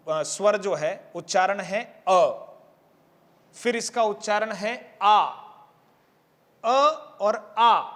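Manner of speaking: slow, drawn-out अ and आ; vowel length. Shown as a demonstration. Teacher showing students the short and long vowel. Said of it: "उच्चारण में लगने वाले समय को लेकर भेद है".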